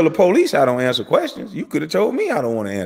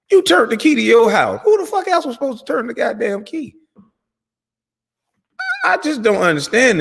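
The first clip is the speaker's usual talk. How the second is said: exasperated outburst; 8 semitones higher than usual. Real, not acted. Exasperated rant at the guest.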